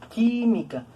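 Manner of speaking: "Química" with QUÍ high and drawn out; stress on first syllable. Shown as a demonstration. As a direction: hold the QUÍ of "Química", then drop. Teacher sounds out stressed syllable.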